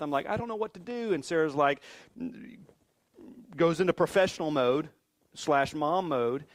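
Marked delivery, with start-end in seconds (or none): 0.3-1.1 high-pitched helpless retelling; mock panic, pitched high; acted-out panic. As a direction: act out being at a loss. Retelling his panic over son's injury.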